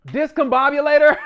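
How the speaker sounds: loud, high mock disbelief; incredulous. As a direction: blurt the odd word out as a question. Mock disbelief at the odd word.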